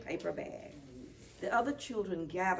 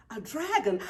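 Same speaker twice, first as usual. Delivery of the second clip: louder breathless gasp of wonder; 3 semitones higher, 7 dB louder than usual. A narrator's reading. Friends spotting the old dragon costume, gasping. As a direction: gasp it in amazement. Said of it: "gasp Sam and Willa".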